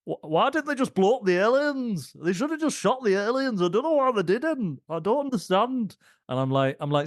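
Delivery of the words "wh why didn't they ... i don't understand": exaggerated high-pitched moaning impersonation; mocking sister. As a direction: imitate a sister's baffled whine, much higher. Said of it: "my sister came out, and she was like".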